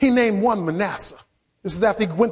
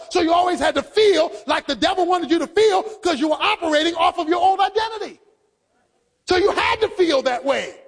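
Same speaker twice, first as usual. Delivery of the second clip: preaching at full force; 5 semitones higher than usual. Acted. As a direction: drive it home to the congregation.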